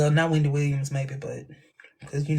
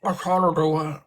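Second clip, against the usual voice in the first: put-on Australian accent. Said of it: "Australian accent".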